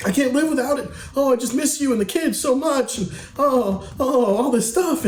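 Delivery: very high, pleading mock whine of a needy man; mock a grown man begging like a child for what he lost; whiny husband mockery. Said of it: "and you're like".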